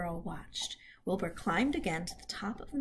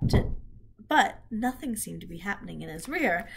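usual speaking voice

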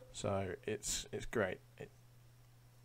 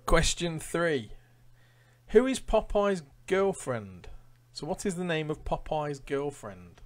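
louder, higher quizmaster read-out; quiz question read; 5 semitones higher, 8 dB louder than usual. Acted. Quizmaster reading questions to Facebook Live viewers, announcer style.

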